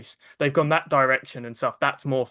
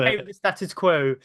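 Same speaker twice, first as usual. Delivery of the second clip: mock British voice; 4 semitones higher than usual. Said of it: "I had to act up a British voice".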